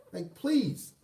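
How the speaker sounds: ordinary delivery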